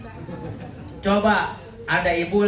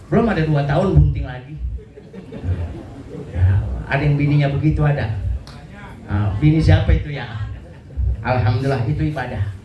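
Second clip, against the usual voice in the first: ordinary talk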